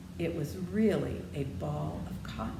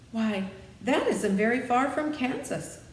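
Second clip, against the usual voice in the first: eager cry; 8 semitones higher, 6 dB louder than usual. Acted. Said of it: "cried Dorothy".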